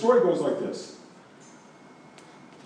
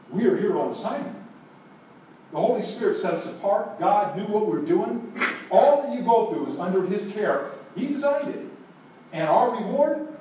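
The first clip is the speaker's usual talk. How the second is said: louder, higher, building fervour; full-force preaching; 7 semitones higher, 3 dB louder than usual. Acted. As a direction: lift the congregation, ringing out.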